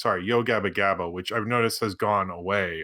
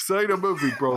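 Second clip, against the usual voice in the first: mock British; 8 semitones higher, faster than usual. Repeats the mock British line.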